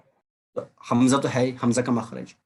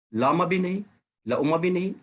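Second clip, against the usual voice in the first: ordinary delivery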